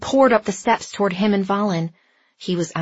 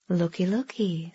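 sly villain voice; 6 dB quieter than usual. A narrator's reading. Sly villain greets them.

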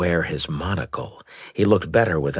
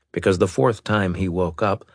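plain reading voice

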